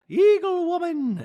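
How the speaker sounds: very high, loud, drawn-out name; mimicked housewife. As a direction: announce it in a squealed mock voice. Mimicking Dorit's answer.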